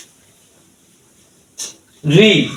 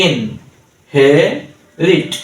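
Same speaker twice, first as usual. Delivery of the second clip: ইন, হে, রিট each said alone with a clear pause; three separate, measured syllables: ইন, হে, রিট; split into syllables. Shown as a demonstration. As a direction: dictate ইন হে রিট one piece at a time. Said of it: "three সিলেবলে ভাগ করেছি".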